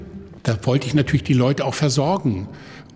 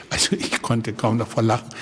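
normal talking voice

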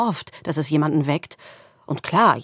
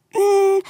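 squirrel whimper; 6 dB louder than usual. A narrator's reading. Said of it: "macht das Eichhörnchen".

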